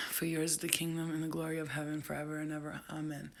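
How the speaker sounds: soft, hushed Lord's Prayer; murmured prayer, steady and hushed; quiet prayer. Real, not acted. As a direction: pray under your breath for protection. Recites prayer when scared.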